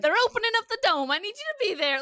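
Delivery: put-on fast, high-pitched Brooklyn-accent impersonation; mock Brooklyn accent. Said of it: "sounds like he's from Brooklyn".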